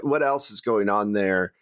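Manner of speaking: usual speaking voice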